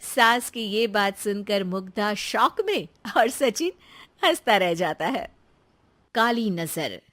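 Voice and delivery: low, even storyteller voice; calm narrator telling the family story; story narration. A narrator's reading. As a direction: narrate the scene to listeners. Narrator describes scene.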